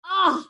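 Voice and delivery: loud frustrated ugh; pained groan. Acted. Sore boy groans at his aching muscles.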